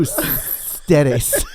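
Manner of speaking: long stuck "s" inside "Ustedes"; exaggerated stammer on "Ustedes"; mock stutter. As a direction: stall on the s of "Ustedes", then push it out.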